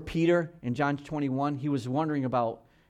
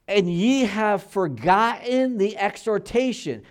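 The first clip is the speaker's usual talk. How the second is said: put-on slow and higher, drawing out "exhortation" like an old prophet; slow solemn scripture reading; 5 semitones higher, 6 dB louder, slower than usual. Shown as a demonstration. Pastor to his church, intoning scripture slowly and gravely. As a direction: let "ye have forgotten the exhortation" hang slowly and gravely. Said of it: "Now, what's he talking about?".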